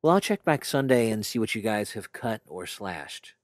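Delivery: ordinary talk, nothing marked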